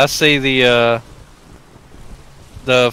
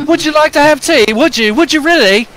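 high, louder posh tea offer; mock British; 6 dB louder than usual. Friend joins the joke in hammed-up British.

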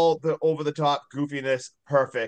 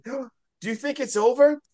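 high, slow frightened ask; timid question; 9 semitones higher, slower than usual. Retold or imitated. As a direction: ask it scared and small. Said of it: "we hear Debbie say".

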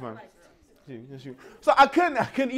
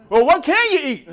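mock concern; 7 semitones higher, 6 dB louder than usual. Table presses him.

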